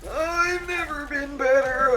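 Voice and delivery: high, hammy character voice. Retold or imitated. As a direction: play it like a cartoon hero. Repeating line in a voice.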